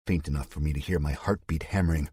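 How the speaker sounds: ordinary delivery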